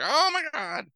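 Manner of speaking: high, shocked "oh my God" as she topples; startled gasp. Acted. Sleeping nanny waking up tied to a chair, startled high squeal. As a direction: squeal it out in sudden alarm. Babysitter wakes up tied, falls backwards.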